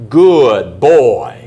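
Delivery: loud, drawn-out praise for a dog; praising. Acted. Owner praises puppy for using the papers, hearty praise. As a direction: praise the puppy loudly, stretching the words. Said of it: "go over and praise him".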